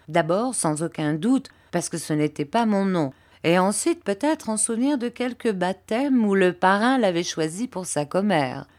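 ordinary talk

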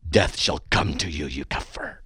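low, menacing curse; angry curse-you voice. Shown as a demonstration. Host shows the right way to read a death threat, angry and low. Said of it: "You gotta do it like this".

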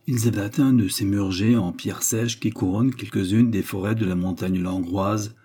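ordinary delivery

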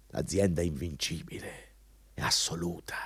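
hushed, almost whispered awe; reverent whisper. Acted. Manager to job seeker, revealing the company's mystique in a hushed whisper. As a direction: breathe it like a sacred secret. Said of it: "qui entriamo nel mondo mistico".

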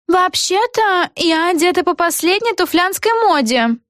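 high-pitched character voice; Эгги declaring. A narrator's reading. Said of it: "заявила Эгги".

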